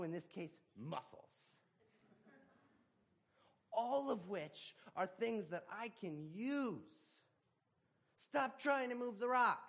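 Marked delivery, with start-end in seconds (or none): 3.7-9.6 higher, louder, slow preaching shout; shouted sermon climax. Acted. God commands the man to quit forcing the rock, shouting. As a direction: hammer the point home to the congregation.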